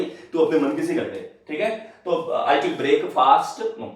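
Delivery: ordinary talk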